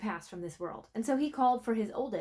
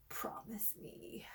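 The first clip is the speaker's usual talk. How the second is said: slow, unvoiced dying plea; pleading whisper; 17 dB quieter, much slower than usual. Old king gripping his servant's hand, whispered plea. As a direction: beg with your last breath, slowly.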